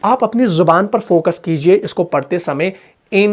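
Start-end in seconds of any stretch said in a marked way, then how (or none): 3.1-3.3 इन held long with the tongue tip on the palate; held nasal n. Shown as a demonstration. Teacher stretches the sound for learners. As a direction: hum the n of इन long. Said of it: "इसको लंबा खींच कर देखें आप".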